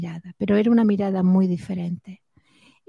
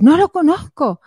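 higher, louder acted denial; frightened shouted denial; 7 semitones higher, 5 dB louder than usual. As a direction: cry it out as Simón panicking. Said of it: "ese era Simón gritando". (negación gritada con miedo)

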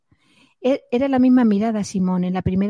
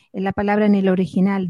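normal talking voice

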